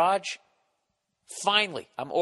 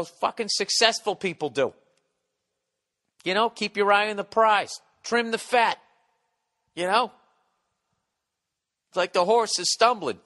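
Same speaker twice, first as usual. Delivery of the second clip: loud, higher, hammed-up bravado; mock tough-guy bravado; 8 semitones higher, 6 dB louder than usual. Boasting to his friend about abandoning the car, loud mock bravado. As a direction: puff up like a ruthless winner.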